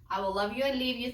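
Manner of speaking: usual speaking voice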